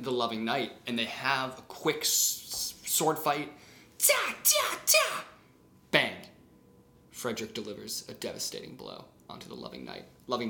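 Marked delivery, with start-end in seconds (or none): 4.0-5.2 high, loud playful clang sounds; mock sword clash noises. Narrator voicing the sword fight, high playful clangs. 5.9-6.1 bang. Acted. Sword fight blow effect.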